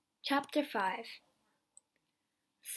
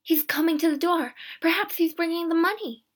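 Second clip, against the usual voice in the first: higher and louder, rushing with eager hope; excited; 7 semitones higher, 7 dB louder than usual. Acted. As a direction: she can't contain her hope at the door. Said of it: "She was very excited".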